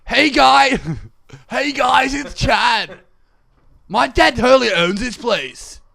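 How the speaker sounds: put-on high, loud, over-the-top American frat-boy voice; mock American accent. Shown as a demonstration. Showing his friend his American accent, loud and hammed-up. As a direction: ham up a brash American teen. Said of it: "I'll show you my American accent".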